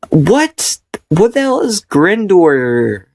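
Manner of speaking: high, baffled outrage; incredulous. Real, not acted. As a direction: scoff at the fancy word. Reacting to fancy word.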